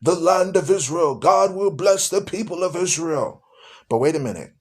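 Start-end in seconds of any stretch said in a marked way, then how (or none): 0.0-3.4 John Hagee. Retold or imitated. Mimicking a televangelist blessing Israel, grand oratory. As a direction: imitate a prominent orator proclaiming a blessing. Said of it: "That's how he talks".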